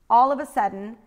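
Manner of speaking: ordinary delivery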